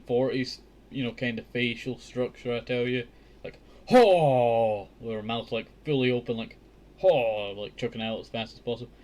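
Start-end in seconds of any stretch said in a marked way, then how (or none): 3.9-4.9 loud open-mouthed heave; hammed-up throwing grunt; mock effort shout. Imitating mother hurling beans. 7.0-7.5 loud open-mouthed heave; hammed-up throwing grunt; mock effort shout. Imitating mother hurling beans.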